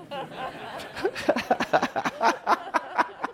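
breathy high laugh; unvoiced giggle; laughing at own pun. Real, not acted.

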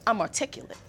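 ordinary talk

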